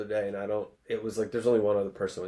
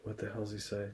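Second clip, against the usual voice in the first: ordinary talk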